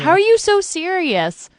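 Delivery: put-on high, loud, awed gushing at a child actor; gushing fan. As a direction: marvel at the kid like a starstruck fan. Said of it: "those child actors that you're like".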